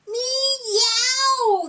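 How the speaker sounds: high, loud cartoon meow; loud kitten meow. Narrator voicing a kitten, high loud meow. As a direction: yowl like a kitten.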